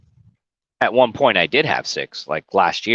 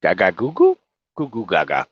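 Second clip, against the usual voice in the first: put-on silly baby babble; baby talk. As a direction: coo it like a babbling infant. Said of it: "I'm like a Gerber baby".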